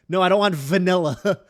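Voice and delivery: loud mock refusal, hitting the V of "vanilla"; emphasized V. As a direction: punch out "vanilla" with a showy V. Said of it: "Like, she says vanilla".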